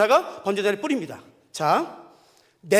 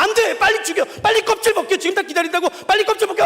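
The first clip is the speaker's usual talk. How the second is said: much higher, louder frantic shouting; urgent yelling; 7 semitones higher, 6 dB louder than usual. Retold or imitated. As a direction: yell at him to hurry up and skin it. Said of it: "retelling himself yelling at the brother". (형제에게 빨리 하라고 다그치는 고함)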